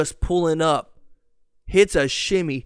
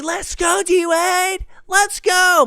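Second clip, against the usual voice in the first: put-on squeaky, high-pitched teenage fan cheering; prepubescent boy cheer; 12 semitones higher, 3 dB louder, slower than usual. Sixteen-year-old cheering on his team, high boyish squeal. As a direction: cheer way up high like an unbroken-voiced kid. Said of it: "My balls hadn't dropped yet".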